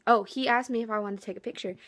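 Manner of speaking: usual speaking voice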